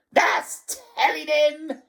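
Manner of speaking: high, loud screech of approval; piercing, gleeful jeer; shrieking old harridan. A narrator's reading. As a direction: shriek it in gloating triumph. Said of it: "shrieked Missus Pratchett".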